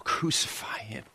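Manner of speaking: hushed, voiceless whisper. Real, not acted. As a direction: breathe it out in grief. Crowd crying out, whispered.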